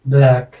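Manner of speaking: "ब्लैक" with an open "ae" vowel; careful "ब्लैक", open short-a sound; pronunciation demo. Shown as a demonstration. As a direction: set "ब्लैक" apart as a model word. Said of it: "ये वाले ए".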